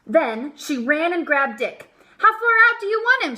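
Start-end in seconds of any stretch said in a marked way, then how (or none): 2.2-3.3 high, loud and fast call. A narrator's reading. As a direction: call it across the field. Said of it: "she called".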